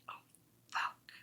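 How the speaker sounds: breathy whispered oh fuck, barely audible; whispered curse. Retold or imitated. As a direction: mutter it under your breath in dismay. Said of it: "And I'm like".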